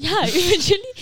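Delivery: laughing through the words. Real, not acted. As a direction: say it while still laughing. Laughing right after shriek.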